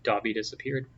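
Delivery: plain reading voice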